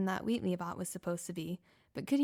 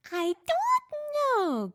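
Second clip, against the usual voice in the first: very high, loud, slow squeaky yell; robot yell; 8 dB louder, much slower than usual. Acted. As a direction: shout it out cluelessly like a little robot. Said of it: "Gur yelled".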